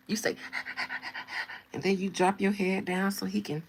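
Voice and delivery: normal talking voice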